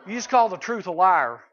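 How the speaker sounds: ordinary delivery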